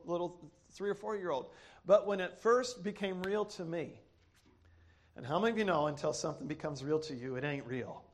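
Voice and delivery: low, softened preaching voice; solemn testimony. Real, not acted. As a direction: drop low and quiet, confiding a deep conviction. Hushed, solemn testimony to congregation.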